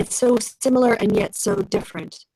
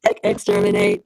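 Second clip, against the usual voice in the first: low grinding robot shout; Dalek voice. Pretend. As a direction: bark it like a Dalek. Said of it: "Say it".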